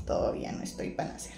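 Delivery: near-whispered retold thought; hushed aside. Mother-to-be thinking it is not time, hushed. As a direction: drop to a soft, voiceless murmur.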